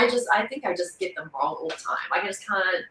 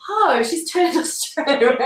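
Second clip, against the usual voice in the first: mock surprise; 7 semitones higher, slower than usual. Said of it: "I'm like".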